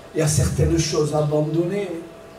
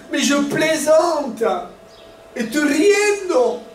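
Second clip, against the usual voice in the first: higher, louder, slow mock excuse; 9 semitones higher, 3 dB louder, slower than usual. Preacher mocking people who excuse rude words, slow high mock excuse. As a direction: ham up a flippant just kidding. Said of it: "Mais des gens disent".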